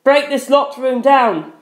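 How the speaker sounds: high, slow pleading shout. A narrator's reading. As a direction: shout at her to stop. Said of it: "I shouted".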